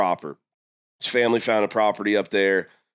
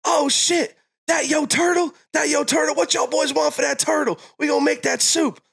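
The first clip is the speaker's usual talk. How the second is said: much higher, hammed-up drunk neighbor shouting; Tyrone. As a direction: holler it like a tipsy neighbor thrilled by a turtle. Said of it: "Comes out and he goes".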